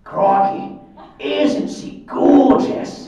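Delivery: loud, drawn-out hammed-up Australian; big Aussie wildlife-host exclamation, pitched high; Australian accent. Shown as a demonstration. Showing off his best Australian voice, hammed-up Australian. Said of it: "everyone's best Australian voice".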